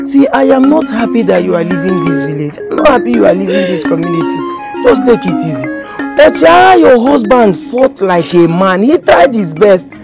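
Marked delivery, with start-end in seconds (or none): 0.0-5.7 low, soft comforting; gentle consoling. Acted. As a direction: soothe her like a grieving friend. Consoling the grieving widow.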